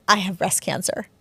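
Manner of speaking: usual speaking voice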